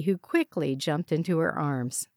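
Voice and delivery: ordinary delivery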